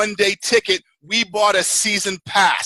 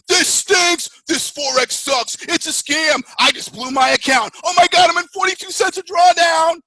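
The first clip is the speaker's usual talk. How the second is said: mock trader meltdown; 7 semitones higher than usual. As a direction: complain like a trader who just blew the account. Trader panicking after losses.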